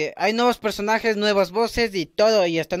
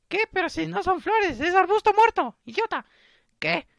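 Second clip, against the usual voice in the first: high falsetto, indignant; cartoonish girly outrage; scornful girl friend; 12 semitones higher than usual. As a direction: scold in a squeaky put-on girl's voice.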